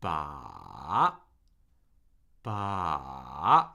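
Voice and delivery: slow dip-and-rise on "Ba."; third-tone demo. Shown as a demonstration. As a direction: stretch "Ba." down low and swing it up. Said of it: "ending on a pitch that's higher".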